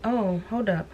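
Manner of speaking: ordinary delivery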